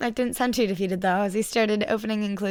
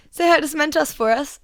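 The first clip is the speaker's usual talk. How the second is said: calling after her; 6 semitones higher, 7 dB louder than usual. A narrator's reading. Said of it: "called after".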